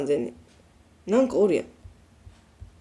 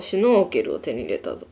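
lower, mock triumphant game voice; deep announcer voice; 8 semitones lower than usual. Mock announcement after よーし.

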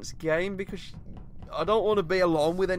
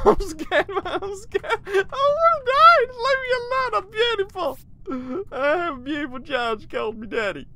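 much higher, louder hammed-up blubbering; mock sobbing; 11 semitones higher, 6 dB louder than usual. Begging the imposter to spare him, mock sobbing. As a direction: fake cry for pity, begging to be spared.

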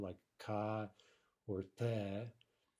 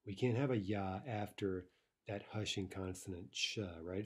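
normal talking voice

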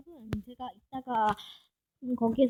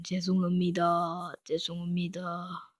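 slow, low, bowing apology; hammed-up formal apology; mock solemn apology; 5 semitones lower, 5 dB quieter, slower than usual. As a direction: drag out each 죄송합니다 low and slow like a deep bow. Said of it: "절할게요".

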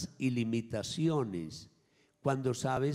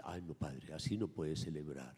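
dismayed parishioner; 6 semitones lower, 6 dB quieter than usual. Retold or imitated. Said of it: "Y dice".

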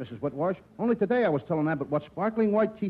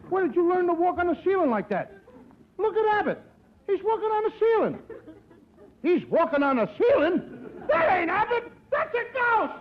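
rising terror; 12 semitones higher than usual. Acted. Costello sees a ghost on the ceiling, terrified shrieking. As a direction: build from nervous chatter to screaming.

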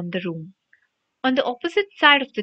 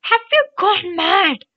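high, loud, slow outraged bellow; drawn-out exasperated yell; 9 semitones higher, 5 dB louder than usual. A narrator's reading. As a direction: bellow it in disbelief. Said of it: "Ron bellowed".

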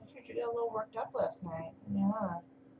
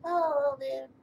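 higher, louder hello there; baby-talk greeting; 5 semitones higher, 8 dB louder, slower than usual. Acted. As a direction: greet the babies sweetly. Greeting the kittens.

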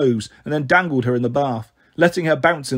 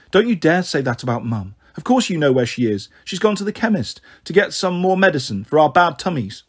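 ordinary delivery, nothing marked

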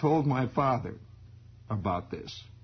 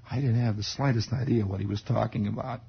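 ordinary talk

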